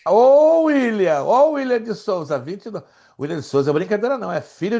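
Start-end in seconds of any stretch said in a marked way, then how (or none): 0.1-2.4 excited call. Real, not acted. Praising the student who answered.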